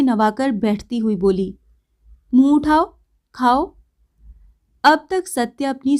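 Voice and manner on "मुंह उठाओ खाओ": coaxing command. Acted. Bijli coaxing him to eat.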